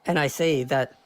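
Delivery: usual speaking voice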